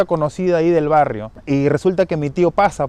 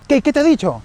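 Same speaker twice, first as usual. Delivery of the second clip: high, urgent disbelief, acted out; imitated startled question; 11 semitones higher, 4 dB louder, faster than usual. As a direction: play a startled relative demanding to know. Startled cousins question frightened Jorge. (pregunta sobresaltada, aguda, imitada)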